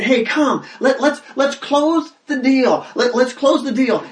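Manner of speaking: God calling out to close the deal; eager invitation. Retold or imitated. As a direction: call them in, eager and urging. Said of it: "God's calling out and saying".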